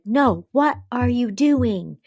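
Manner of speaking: slow, pointed question; slow demanding. Retold or imitated. Carrie demanding answers, slowly and pointedly. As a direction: drag out the demand. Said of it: "And she's like".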